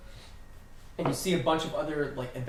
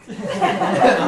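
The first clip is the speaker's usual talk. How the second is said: hammed-up grumbling curse, louder; mock exasperated curse; 7 semitones higher, 12 dB louder, much faster than usual. Game master voicing the fed-up cook, loud mock curse. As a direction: grumble it with a laugh under it.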